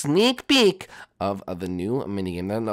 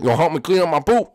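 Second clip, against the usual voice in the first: ordinary delivery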